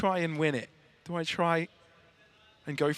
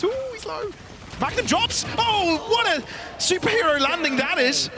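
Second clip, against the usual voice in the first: higher, rising caster call; excited play-by-play; 11 semitones higher than usual. Acted. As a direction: call the action live. Live play-by-play.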